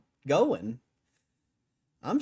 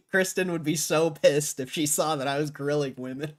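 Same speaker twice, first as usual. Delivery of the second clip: laughing; 2 semitones higher, 5 dB louder than usual. Real, not acted. Laughing at himself.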